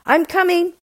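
high-pitched hurried answer; high husband voice, pleading; anxious husband. A narrator's reading. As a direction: call back obediently in a high voice. Husband answers Bluebeard's threat.

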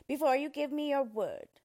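loud, high dwarf demand; emphatic, bossy dwarf voice; insistent dwarf. A narrator's reading. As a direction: press firmly for a promise. Dwarf presses for a promise.

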